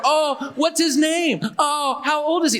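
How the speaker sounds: high, drawn-out cooing over a dog; gushing dog admirer. Retold or imitated. Girls coming over to meet the dog, gushing. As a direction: imitate a girl fawning over a puppy.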